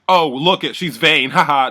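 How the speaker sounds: loud, high mocking with fake laugh; retold jeering. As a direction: imitate him poking fun at her. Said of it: "make fun of the fact".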